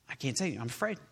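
soft, beaten-down admission of fear; imitating a quitter. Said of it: "It's like".

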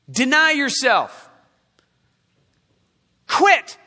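forceful preaching command. Real, not acted. Preacher giving Jesus' answer to anger, forceful command. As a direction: drive each command home to the congregation.

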